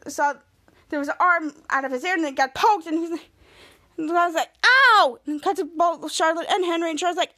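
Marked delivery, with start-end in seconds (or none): 4.6-5.2 high, loud "ow"; mock pained yelp. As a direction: play-act getting poked, yelping. Retold man poked in eye.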